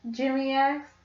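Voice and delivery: ordinary delivery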